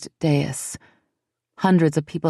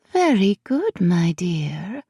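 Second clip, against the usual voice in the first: purred; 4 semitones higher, slower than usual. Acted. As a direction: purr it like a pleased cat. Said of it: "she purred".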